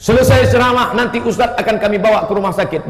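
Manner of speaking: hammed-up hospitable offer; a lightly voiced eager host, pleased with his plan. Voicing the committee's offer.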